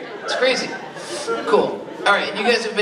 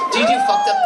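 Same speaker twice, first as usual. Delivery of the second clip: much higher, loud outburst; sudden shout; 4 dB louder than usual. Real, not acted. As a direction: burst out yelling the question. Sudden yell at crowd.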